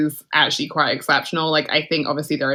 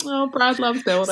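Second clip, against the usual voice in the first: higher, teasing gush about Brad; mock fond; 6 semitones higher than usual. Host teasing Brad's architecture love, higher and playful. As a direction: coo it like a fan's cliché. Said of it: "And I'm like".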